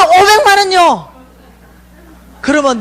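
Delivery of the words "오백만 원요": much higher, loud, drawn-out answer; eager blurt. Retold or imitated. A daughter answering God, high, loud and slow. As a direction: stretch it out, thrilled.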